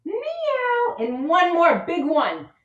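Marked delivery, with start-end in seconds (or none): 0.0-1.0 cat meow. Pretend. Teacher meowing in cat pose for kids. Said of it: "Two more".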